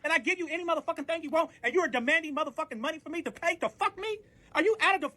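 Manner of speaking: high-pitched, relentless rant; outraged comeback. Retold or imitated. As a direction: pile up the grievance in disbelief. Retold furious comeback in quarrel.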